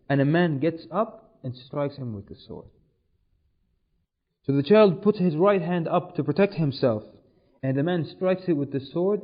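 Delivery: ordinary delivery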